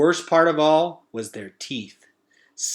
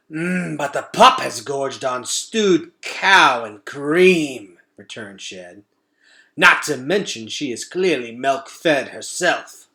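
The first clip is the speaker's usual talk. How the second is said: slow, louder savouring voice; gourmet rat; 2 semitones higher, 6 dB louder, slower than usual. Acted. Shed boasting of the toddler's scent, savouring. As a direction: roll the food words around. Rat answers about the pup.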